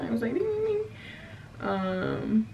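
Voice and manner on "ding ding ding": high-pitched "ding, ding, ding"; imitated alert dinging. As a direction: mimic a little alarm up high. Imitating the cop lights going off.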